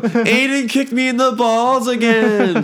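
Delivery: put-on high, whiny child yelling for mom; whiny kid. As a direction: whine like a hurt little kid. Kid calling mom after groin kick.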